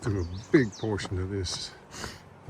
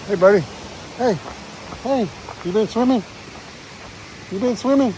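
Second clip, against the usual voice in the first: much higher and louder, cheerful pet voice; talking to a dog; 12 semitones higher, 7 dB louder, faster than usual. Real, not acted. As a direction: coo at a wet dog like a friendly stranger. Greeting a dog on trail.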